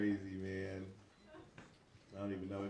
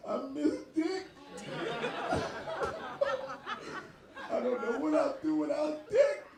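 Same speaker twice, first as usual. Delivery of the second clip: high, wailing mock grief through laughter; mock crying; 10 semitones higher than usual. Comedian mock mourning a friend named Dick, high wail. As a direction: fake a tearful wail. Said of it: "I'm sitting there crying".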